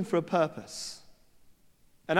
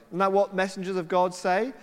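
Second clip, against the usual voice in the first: usual speaking voice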